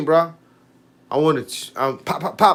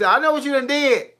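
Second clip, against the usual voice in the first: wife accusing; 5 semitones higher than usual. Retold or imitated. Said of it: "She said".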